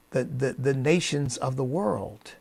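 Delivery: normal talking voice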